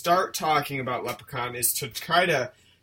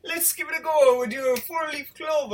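high, hammed-up Irish; botched leprechaun-style Irish; bad Irish accent; 11 semitones higher than usual. Host insisting he is good at it, bad Irish accent. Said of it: "No, not even close".

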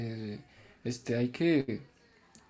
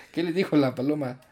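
usual speaking voice

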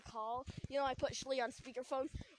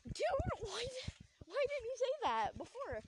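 much higher, disbelieving why; incredulous; 11 semitones higher than usual. Retold or imitated. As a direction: throw the question up high in disbelief. Exasperated at friend's news.

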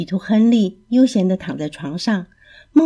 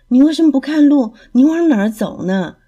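louder, fast annoyed outburst; angry complaint; 6 semitones higher, 5 dB louder than usual. A narrator's reading. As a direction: snap at the snail for bumping you. Henry snaps at the snail. (亨利生气地冲蜗牛大声抱怨)

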